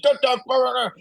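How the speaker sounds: mock freak-out in a very high voice; going nuts. Old man frantic over the ducks, mock freak-out. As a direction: imitate a man losing it, squawked high and garbled. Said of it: "He's, like, going nuts".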